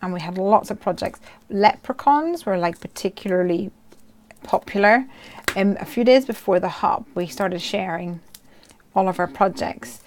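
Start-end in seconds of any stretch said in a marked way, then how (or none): none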